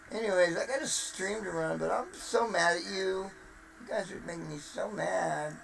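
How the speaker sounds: normal talking voice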